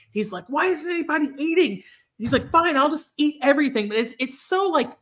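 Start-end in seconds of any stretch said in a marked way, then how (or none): 0.5-3.9 retold yelling. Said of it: "the dad starts yelling".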